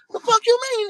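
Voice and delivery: shocked outburst. Retold or imitated. The teller hearing the man died, shocked and loud. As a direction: react in total disbelief.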